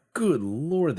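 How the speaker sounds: a high, loud yelp of disbelief; shocked exclamation. Real, not acted. Player reacting to the tiny ship, shocked high yelp. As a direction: gasp out at how tiny it is.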